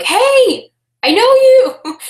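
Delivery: high, loud, overexcited greeting; Buddy from Elf. Acted. Greeting the neighbor at the bins, loudly overexcited. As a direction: shout hello like an overjoyed elf. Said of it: "like Elf?".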